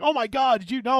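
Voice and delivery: high-pitched gushing imitation; mock excitement. Host mimics internet rediscovering things, mock excitement. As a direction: imitate someone amazed by old news.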